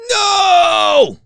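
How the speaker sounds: sudden high yelled no; anguished cry. Real, not acted. Host on air wailing at missing shirt. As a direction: cry out in real dismay.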